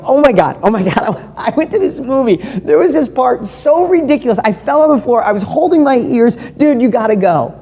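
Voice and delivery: breathless over-the-top enthusiasm; excited gushing. Shown as a demonstration. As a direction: gush like you can't contain it. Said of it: "Or do you go".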